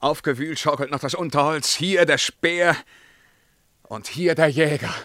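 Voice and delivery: loud, high, feverish cry of the hunt; a ruler drunk on the hunt, loud and high; feverish excitement. Acted. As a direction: shout it out, swept up in the chase. Emperor's hunting monologue, excited.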